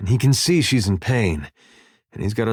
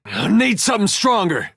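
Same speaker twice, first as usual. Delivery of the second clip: high, strained battle complaint; frustrated combat bark; 12 semitones higher than usual. Acted. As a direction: call out frustration mid-fight. Combat bark, weapon not working.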